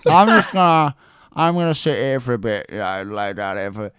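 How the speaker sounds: a hint of a drunk mate dozing; drunk mumble imitation. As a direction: slur it like a drunk about to nod off.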